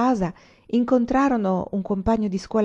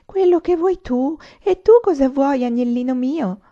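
high, loud mimicry of an awkward boy; mocking imitation; 8 semitones higher, 7 dB louder than usual. A narrator's reading. Tavern girl aping the shepherd boy's voice, mockingly. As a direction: mimic him teasingly, voice pitched way up. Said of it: "si mise a rifare la voce e l'atteggiamento di Zuanne".

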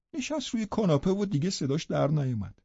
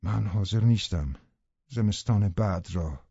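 lower, louder, different narrator voice; a reserved gentleman recounts his plans, lower; the tenant narrating; 8 semitones lower, 4 dB louder than usual. A narrator's reading. The tenant tells the reader he is leaving, in a lower, fuller voice. Second narrator takes over.

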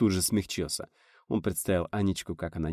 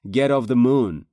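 drawn-out, louder English with American flavour; mock American slogan; 4 semitones higher, 8 dB louder, slower than usual. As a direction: chant it like a mocking American protester. Acts out American protest placards. (передразнить американский лозунг)

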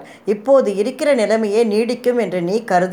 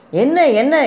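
jolted awake, much higher and louder; startled; 7 semitones higher, 4 dB louder than usual. A narrator's reading. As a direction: snap awake in alarm. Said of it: "திடுக்கிட்டு எழுந்து". (திடுக்கிட்டு உரத்த கேள்வி)

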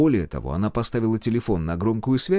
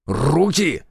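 sudden higher-pitched shout; shouted command; 5 semitones higher, 4 dB louder than usual. A narrator's reading. Major spots her reaching for a pocket, shouting. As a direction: shout it as an order. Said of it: "крикнул Комаров".

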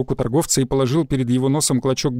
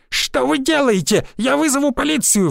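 much higher, panicked squeal; 10 semitones higher than usual. A narrator's reading. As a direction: shriek it out like a cornered pawnbroker. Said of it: "взвизгнул торговец". (испуганный визг, намного выше)

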